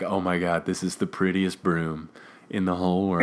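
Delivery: imitated awe, low and soft; hushed admiration. Two boys staring at the new broom, hushed admiration. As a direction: murmur as if gazing at a treasure. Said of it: "stare at the firebolt in admiration".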